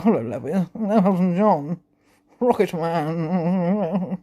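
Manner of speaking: lightly voiced singer, last words drawn out; John impression. Trying a John he couldn't really do, half-hearted impression. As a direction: put on a light impression, stretching the end. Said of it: "I never did that when John".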